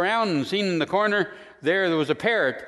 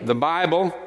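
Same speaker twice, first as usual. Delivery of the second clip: solemn faith declaration; 5 semitones lower than usual. Acted. Opening creed declaration with music.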